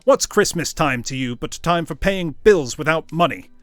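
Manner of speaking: loud, heated tirade; angry rant. Acted. Scrooge railing at his nephew, loud angry tirade. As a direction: rail against Christmas.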